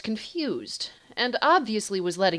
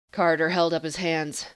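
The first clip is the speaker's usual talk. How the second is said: ordinary delivery, nothing marked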